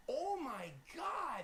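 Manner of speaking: high, slow, drawn-out gasp of disbelief; old schoolmate suddenly recognizing him, amazed; stunned recognition. Retold or imitated. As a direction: let the realisation dawn slowly.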